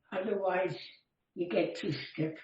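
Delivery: ordinary talk, nothing marked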